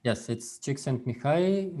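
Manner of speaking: ordinary delivery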